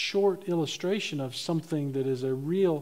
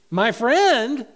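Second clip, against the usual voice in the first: "My friend?" higher and louder in disbelief; preacher's astonished cry; exclaiming; 6 semitones higher, 8 dB louder than usual. Retold or imitated. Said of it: "exclaimed the old preacher".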